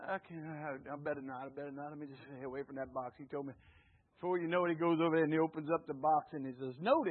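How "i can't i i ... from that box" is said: low, soft acted muttering to himself; hesitant self-talk. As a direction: talk yourself out of it, quietly and haltingly. Joke's worker talks himself out of temptation.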